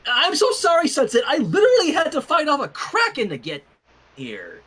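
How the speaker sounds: flustered apology. Acted. Twilight's frantic apology voiced.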